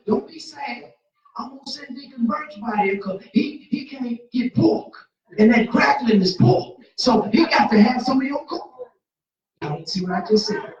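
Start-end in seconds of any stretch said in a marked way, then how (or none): 0.1-8.9 loud, high, fired-up preaching about cornbread; rising preacher's holler; full-force preaching. Acted. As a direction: preach it loud to the whole church.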